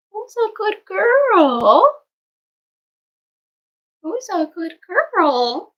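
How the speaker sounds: high, slow sing-song to the puppies. Real, not acted. Greeting newborn puppies in high baby talk. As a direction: coo at them like babies. Praising puppy.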